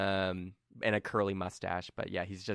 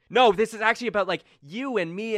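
Colm earnest; 12 semitones higher, 8 dB louder than usual. Acted. GM voices Colm.

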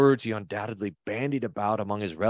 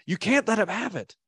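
put-on much higher, pleading voice of Esau's children; urging children; 10 semitones higher than usual. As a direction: egg him on like an upset child. Children urging Esau to rage.

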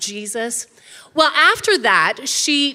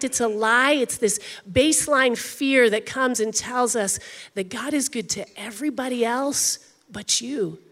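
ordinary talk

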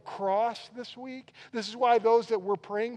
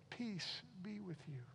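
much softer, calm peace; gentle quiet greeting; 17 dB quieter than usual. Retold or imitated. Preacher quoting Jesus greeting the frightened disciples, softly. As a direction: say it softly, like a blessing. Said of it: "he says".

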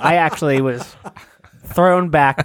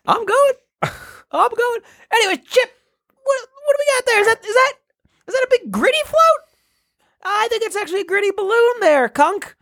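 higher, excited radio announcer, climbing much higher at the floats; parade reporter voice, rising. Acted. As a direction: get more and more worked up pointing at the floats. Continues reporter bit.

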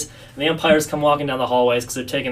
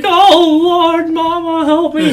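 high, loud, drawn-out mock wail; hammed-up panicked cry for mama; mock crying baby; 8 dB louder, much slower than usual. Big ballplayer on a falling plane, imitated as a wailing baby. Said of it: "he was a total baby".

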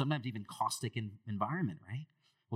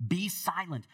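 higher, louder stern command; commanding rebuke; 7 semitones higher, 4 dB louder than usual. Acted. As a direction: silence him with authority. Repeating Jesus' command.

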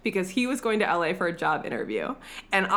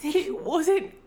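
high, hammed-up Australian plea; bad Australian accent; 8 semitones higher than usual. Lil explaining why it happened, bad Australian accent. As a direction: plead in a put-on Australian accent. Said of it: "I can't do an Australian accent".

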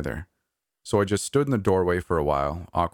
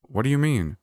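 high, bewildered question; higher, confused asking; 8 semitones higher than usual. Acted. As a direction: demand an answer, thrown. Narrator confused by Dale's news.

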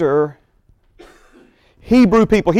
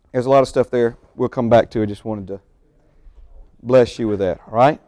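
normal talking voice

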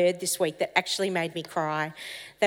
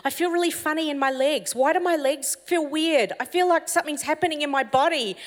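higher, bewildered voice; confused young worker, pitched up; puzzled wonder; 7 semitones higher than usual. Retold or imitated. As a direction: sound baffled by a strange feeling in his legs. Guy feeling something physical happen.

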